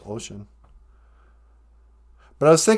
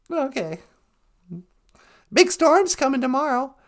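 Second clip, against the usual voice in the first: grandma character voice; 9 semitones higher than usual. Pretend. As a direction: chirp it like a sweet old granny. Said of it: "Nana Bonjour".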